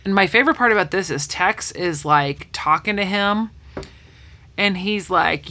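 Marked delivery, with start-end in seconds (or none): none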